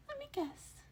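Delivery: very high, smug creature voice; confident high-pitched tease; unbridled confidence. A narrator's reading. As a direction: sure of yourself, playful. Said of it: "with an air of unbridled confidence".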